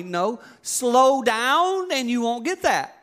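slow, high mock lecture; stern dad. Dad telling son to slow down, slow stern. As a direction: spell it out slowly to the kid. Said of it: "dad answers son".

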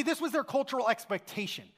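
ordinary talk, nothing marked

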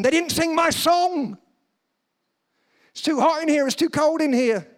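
high, loud mock grumbling; high-pitched, indignant moan; petty complaining. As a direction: play an offended churchgoer griping. Offended churchgoers voiced complaining.

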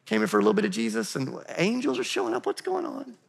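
high, fast mock-bewildered churchgoer; lightly mocking baffled voice; confused listener. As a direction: squeak out the disbelief. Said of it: "You're like".

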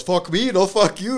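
shouted high and loud, mock furious; mock angry yell. Uncle yelling at Andy over a backwards cigarette, mock angry shout. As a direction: yell it back like an offended uncle. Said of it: "and the guy goes".

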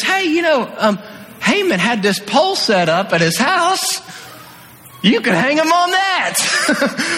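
sly servant offering up an idea; servant suggesting. Retold or imitated. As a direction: servant Harbona proposing to the king, half laughing. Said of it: "he says".

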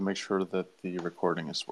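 distant, off-mic voice; speaking away from the microphone; far from mic. Real, not acted. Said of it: "step away from the mic".

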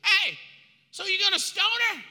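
high, loud demand; loud taunt. Acted. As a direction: call it out to provoke him. Mob presses Jesus.

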